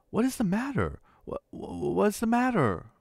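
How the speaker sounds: a startled husband stumbling over his words; stammering. A narrator's reading. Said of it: "He stammered".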